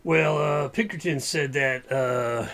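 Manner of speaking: lightly voiced Western sheriff, drawling; a hint of a gruff frontier lawman. GM as sheriff briefing the party, Western drawl.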